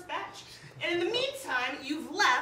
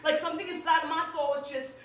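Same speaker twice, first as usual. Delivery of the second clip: intense slam delivery, building louder; spoken word poem; 4 semitones higher, 7 dB louder than usual. Acted. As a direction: pour it out to the audience like a confession. Performs second spoken word poem.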